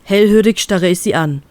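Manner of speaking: plain reading voice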